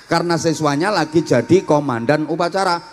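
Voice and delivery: ordinary talk, nothing marked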